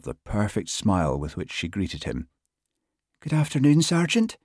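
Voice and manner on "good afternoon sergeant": high, lilting Hebridean woman's voice; soft Western Isles lilt. A narrator's reading. New constable greeting her sergeant, soft Western Isles lilt. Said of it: "in a soft voice delicately laced with an endearing lilt".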